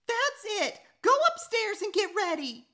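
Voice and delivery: high loud furious order; exploding mom. Acted. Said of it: "Mom exploded".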